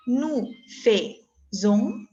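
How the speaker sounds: "Nous faisons" drawn out slowly, nu fezo; slow careful demo. Shown as a demonstration. Teacher slowly modelling "Nous faisons" for Nicole. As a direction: stretch "Nous faisons" syllable by syllable. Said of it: "Pronuncio exactamente lo mismo".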